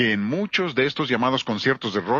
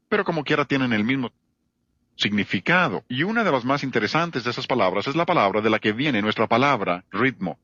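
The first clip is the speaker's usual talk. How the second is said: normal talking voice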